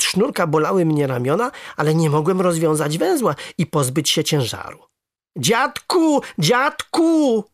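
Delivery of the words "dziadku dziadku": high, wailing cry for grandpa; calling for help. A narrator's reading. Boy with a stone on his back, crying out to grandfather. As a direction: call out in distress, drawing the word out. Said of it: "zawołałem".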